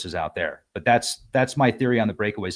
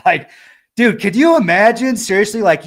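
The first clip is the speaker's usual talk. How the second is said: much higher and louder, bursting with laughter; excited laughing outburst; 8 semitones higher, 9 dB louder than usual. Real, not acted. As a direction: blurt it out, half laughing, amazed. Laughing at imagined abduction scene.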